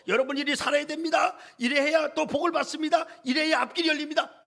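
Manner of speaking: high, loud preaching proclamation; proclaiming preacher. Retold or imitated. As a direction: declare it to the youth like a pulpit. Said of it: "온갖 모든 선포를 다 한". (청년들 앞에서 높고 크게 선포하듯)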